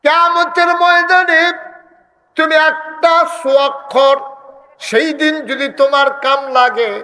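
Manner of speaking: fiery waz preaching; preaching at full force. Acted. Preacher rises to high pitch.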